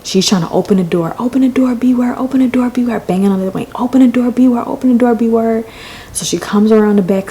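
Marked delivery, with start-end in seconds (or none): 1.2-3.0 high-pitched repeated demand; mock frantic demand. Girlfriend banging to get in, mock frantic demand. 3.8-5.6 high-pitched repeated demand; mock frantic demand. Girlfriend banging to get in, mock frantic demand.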